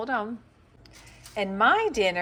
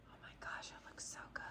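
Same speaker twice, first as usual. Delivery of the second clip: hushed whisper of delight; 22 dB quieter, faster than usual. Real, not acted. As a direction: whisper it, marvelling at the food. Whispering over the food.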